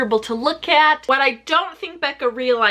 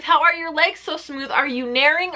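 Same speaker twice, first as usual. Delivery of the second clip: ordinary talk, nothing marked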